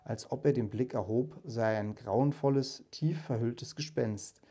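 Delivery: measured storytelling voice, lightly acting the ghost and Scrooge; story narration. A narrator's reading. Narrator reading A Christmas Carol to listeners, storytelling voice. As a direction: read the tale like a fireside audiobook. Reads Dickens aloud, voicing characters.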